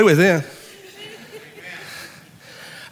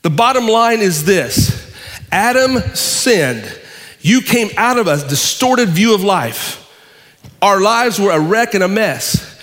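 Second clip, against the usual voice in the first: forceful preaching; 4 semitones higher, 3 dB louder than usual. Real, not acted. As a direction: preach it with full, raised energy.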